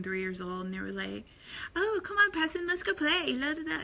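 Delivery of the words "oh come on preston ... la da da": put-on high-pitched little-kid singsong; playful toddlers. Parent imitating two little boys off to play, playful high toddler voice. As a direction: mimic excited small children, pitched way up.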